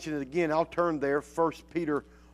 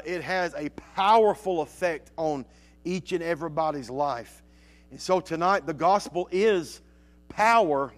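usual speaking voice

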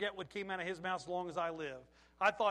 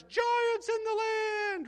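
high, loud, drawn-out wail of alarm; alarmed cry; 13 semitones higher, 9 dB louder, much slower than usual. Acted. Frightened spies reporting on the promised land, alarmed cry.

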